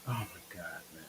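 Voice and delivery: breathy exasperated whisper under the breath; forgetful host whispering in frustration; real whisper. Real, not acted. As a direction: whisper it to yourself, fed up.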